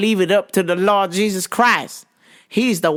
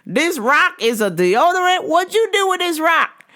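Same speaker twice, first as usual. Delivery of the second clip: pitched-up mock disbelief at the rock; incredulous lady; 8 semitones higher than usual. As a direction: squeak up in outraged disbelief. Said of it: "And she goes".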